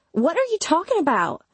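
high-pitched startled demand; startled disbelief. A narrator's reading. Imogen hearing narrator is leaving, startled and high.

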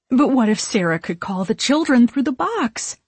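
sudden anxious suggestion; blurted. A narrator's reading. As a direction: blurt the idea out. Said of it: "Mary blurts".

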